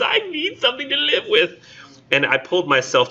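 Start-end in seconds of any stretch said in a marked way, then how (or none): 0.0-1.5 much higher, whiny falsetto; mocking widow. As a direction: whine like the needy widow. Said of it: "she's just".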